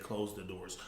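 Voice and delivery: low, soft mock command; imitating the preacher's way. Mocking how the pastor ordered the ushers, hushed. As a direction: copy his smug hush. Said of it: "The way he said".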